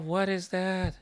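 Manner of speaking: usual speaking voice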